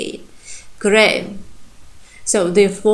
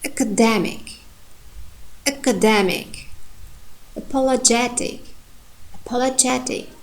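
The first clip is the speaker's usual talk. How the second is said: academic with dem stressed, apologetic with ge stressed; reading with correct stress; 3 semitones higher, 4 dB quieter than usual. Shown as a demonstration. Teacher reads the table with careful stress. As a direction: model each word twice, stress clear. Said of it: "try to read them with correct stress".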